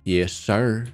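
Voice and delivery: usual speaking voice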